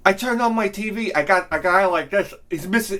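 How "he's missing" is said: normal talking voice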